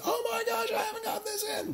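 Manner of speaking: squeaky high mock-panicked student voice, with a laugh. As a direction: play the flustered student who forgot the work. Teacher mimics panicking student.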